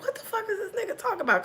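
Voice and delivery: high, fast, hushed and laughing; breathless giggling disbelief. Retold or imitated. Retold inner reaction.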